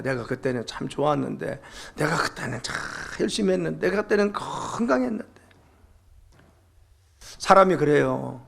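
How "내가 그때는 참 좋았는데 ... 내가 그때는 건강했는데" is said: soft, sighing look back at better days; wistful, quiet recollection. Retold or imitated. Preacher voicing a discouraged believer, softly wistful. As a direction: murmur it like missing the old days. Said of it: "과거를 자꾸 떠올립니다".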